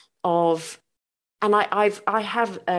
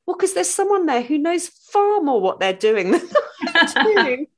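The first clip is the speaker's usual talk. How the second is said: laughing quote; 10 semitones higher, 4 dB louder than usual. Retold or imitated. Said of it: "she's just said".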